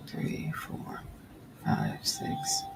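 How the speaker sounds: counting in a whisper under her breath; soft whispered count. Real, not acted. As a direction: whisper the numbers to keep count.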